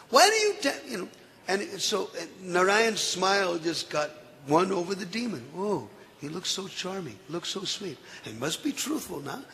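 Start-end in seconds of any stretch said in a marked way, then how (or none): 0.1-0.7 incredulous outburst. Acted. Disguised Narayan mocking. 5.6-9.4 much softer, dreamy musing; hushed, enchanted inner voice; softly charmed. Acted. Demon charmed, thinking to himself.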